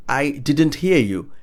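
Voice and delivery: "I didn't hear you" slow, with words separated; slow model. Shown as a demonstration. Teacher showing what to say when not hearing, slow and clear. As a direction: spell out "I didn't hear you" slowly by word. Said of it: "you could say".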